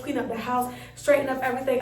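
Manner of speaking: usual speaking voice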